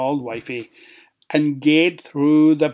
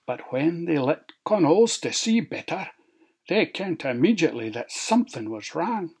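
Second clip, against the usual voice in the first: ordinary delivery, nothing marked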